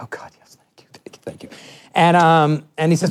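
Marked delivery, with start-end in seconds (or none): 0.0-1.5 breathless whispered thanks, almost no voice; hushed grateful whisper under the breath; relieved whisper. Retold or imitated. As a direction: whisper it like a prayer answered. Whispered relief as the meeting ends.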